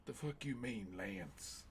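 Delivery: put-on soft, with Lance dropped very low in the movie accent; mock the movie accent again; imitating the movie accent; accent imitation. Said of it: "It's just the accent".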